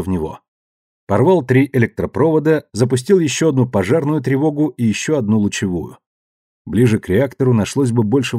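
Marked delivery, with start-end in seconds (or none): none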